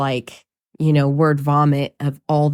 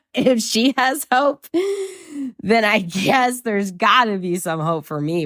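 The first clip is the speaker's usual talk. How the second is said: imagined listener voice; 7 semitones higher than usual. Pretend.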